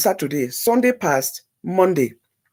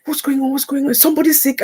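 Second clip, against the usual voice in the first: much higher, alarmed questions; alarmed friend; 7 semitones higher, faster than usual. Retold or imitated. Bride alarmed by her friend's news, much higher. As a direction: ask in sudden alarm. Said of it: "I said".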